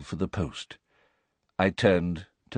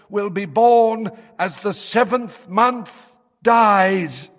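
high, harsh, hoarse fortune teller; slow, loud trance-like seer voice; trance prophecy voice; 10 semitones higher, 4 dB louder than usual. A narrator's reading. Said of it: "in the harsh, hoarse tones".

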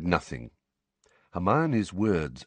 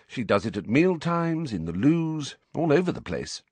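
absent-minded listing in a higher voice; vague; 8 semitones higher than usual. A narrator's reading. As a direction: list it off with attention elsewhere. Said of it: "said Hermione vaguely".

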